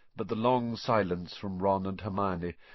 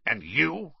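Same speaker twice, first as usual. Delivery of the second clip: vicious; 6 semitones higher than usual. A narrator's reading. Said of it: "said Uncle Vernon viciously".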